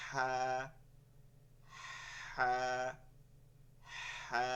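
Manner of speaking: "হা" with a long breath before the vowel; air-first ha. Shown as a demonstration. Teacher drills long ha for students, air first. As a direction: breathe out from the throat, then sound "হা". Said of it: "আগে বাতাসটা, এরপরে হা বলতে হবে".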